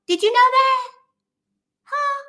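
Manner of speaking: high, loud playful question; mock surprised. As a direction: ask it with a teasing surprise. Teasing sponsor question.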